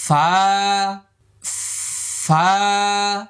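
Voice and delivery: Arabic: فا; airy fa. Shown as a demonstration. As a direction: push air out on ফা. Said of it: "দেখছেন বাতাস".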